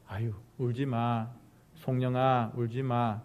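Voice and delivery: low, soft hushing of a crying person; tender consoling. Shown as a demonstration. Preacher showing how to comfort someone crying, tenderly. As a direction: console gently, as if patting them. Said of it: "이렇게 말하는 거하고".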